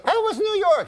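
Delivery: high falsetto wife; high woman's voice. Acted. Wife asks uncle about his trip, high falsetto. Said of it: "high voice".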